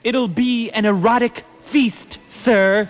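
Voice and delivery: poem recitation. Acted. Host resumes the Christmas parody after an interruption, poem recitation. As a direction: declaim it as campy holiday rhyme.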